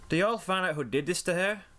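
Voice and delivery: high-pitched character voice, questioning; Applejack. A narrator's reading. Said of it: "Applejack inquired".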